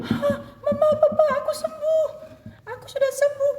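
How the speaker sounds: put-on very high, laughing little girl; healed daughter tells her parents, high and laughing; joyful child. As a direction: squeal with joy at being healed.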